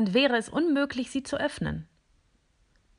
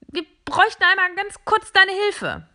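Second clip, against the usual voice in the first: much higher, louder gadget voice; squeaky device voice; 12 semitones higher, 8 dB louder than usual. Acted. Another device asking the robot for help, squeaky. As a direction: voice a little round machine asking a favour.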